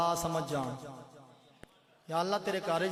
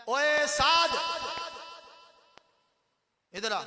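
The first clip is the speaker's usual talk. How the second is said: put-on loud, higher, curt calling of a boy; rough call; 10 semitones higher, 6 dB louder than usual. Shown as a demonstration. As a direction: bark his name across the room. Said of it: "یہ بھی ایک طریقہ ہے".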